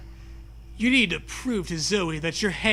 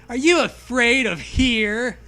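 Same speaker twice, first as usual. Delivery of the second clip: teasing; 5 semitones higher, 6 dB louder than usual. A narrator's reading. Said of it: "Zoe teases".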